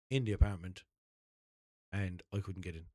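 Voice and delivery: ordinary talk, nothing marked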